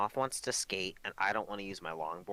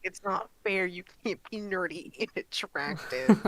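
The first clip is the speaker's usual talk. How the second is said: whiny complaint; 9 semitones higher, 5 dB louder than usual. Retold or imitated. Mock complaint about hot gamers.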